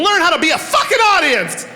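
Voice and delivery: high, loud crowd-pumping shout; hype-man shout. Real, not acted. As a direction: whip the crowd up like a preacher. Hyping the audience.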